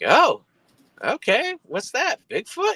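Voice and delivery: very high, rising mock-innocent tone; mock credulous questioning. Driver humouring friend's creature sighting, high mock-innocent questions.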